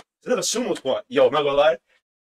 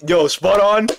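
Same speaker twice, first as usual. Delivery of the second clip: ordinary talk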